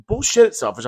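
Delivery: usual speaking voice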